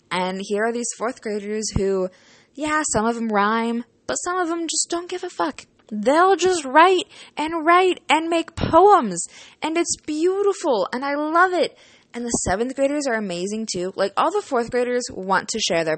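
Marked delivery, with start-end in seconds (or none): 4.1-11.8 excited gushing. Real, not acted. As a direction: rave with delight. Gushing about the kids.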